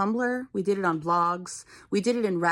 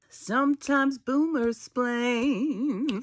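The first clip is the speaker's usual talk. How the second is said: boomer voice; 3 semitones higher, much slower than usual. Pretend. Mock boomer voice, far, slow.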